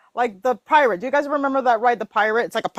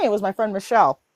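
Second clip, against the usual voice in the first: normal talking voice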